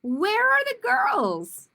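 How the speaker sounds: high, loud, slow outraged cry; a girl stunned there are no women; shocked child. Acted. Grace shocked at poster.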